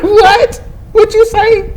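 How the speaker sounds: very high-pitched incredulous questions; mock indignant challenge. Reacting to the kid's cheeky reply, high mock indignation. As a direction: shoot up high in mock disbelief.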